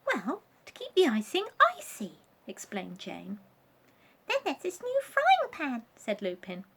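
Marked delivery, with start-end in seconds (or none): 0.0-2.2 much higher small doll voice; a squeaky little doll explaining; Jane voice. Acted. Jane's voice in the story. 4.3-5.9 very high squeaky voice; a tiny high figure showing off; Lupin voice. Acted. Lupin's voice in the story.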